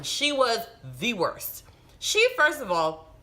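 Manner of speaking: worked-up outrage at the nurse; excited, indignant retelling; getting excited. Real, not acted. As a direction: heat rising as the memory returns. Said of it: "sorry, I'm getting real excited".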